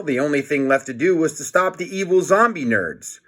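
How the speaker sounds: plain reading voice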